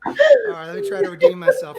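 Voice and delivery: high, loud laughing words; laughing embarrassed recovery. Real, not acted.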